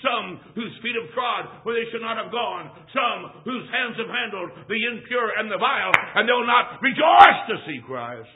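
preacher's list building to a loud shout; impassioned preaching. Real, not acted. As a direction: build up to a loud cry of warning.

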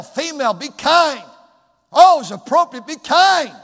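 loud, rising pulpit preaching; preaching at full force. Acted. Pastor exhorting the congregation, loud preaching. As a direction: preach it out with fire to the church.

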